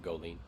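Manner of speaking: "Goleen" with a long ee ending; northern pronunciation. Shown as a demonstration. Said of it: "If you were north".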